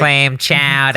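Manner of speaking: Boston accent. Shown as a demonstration. Boston accent bit continues.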